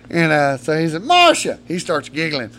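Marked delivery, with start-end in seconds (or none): 1.0-1.6 high holler for someone in another room; summoning shout; calling out. Retold or imitated. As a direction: call her name up high and loud. Calling stepmom over.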